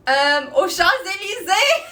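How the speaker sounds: high, loud and laughing through "aux Champs-Élysées"; giggling out a French place name. Real, not acted. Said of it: "That's the wrong country".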